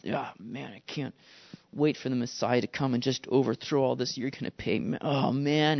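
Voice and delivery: low, soft mock grumbling; grumbling under breath. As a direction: grumble it quietly to yourself. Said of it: "just grumbling under their breath".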